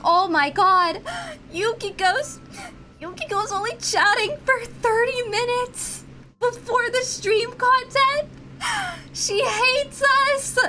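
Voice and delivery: high-pitched mock outrage, slowing at the end; whiny chat imitation. Streamer imitating chat complaining about the wait, high mock wail. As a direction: wail it high as aggrieved fans. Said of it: "chat was like".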